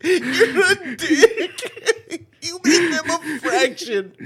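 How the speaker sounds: high-pitched words through real laughter; laughing hard. Real, not acted. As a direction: barely get it out for laughing. Cracking up at friend's remark.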